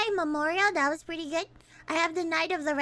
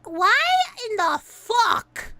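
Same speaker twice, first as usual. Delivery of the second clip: ordinary delivery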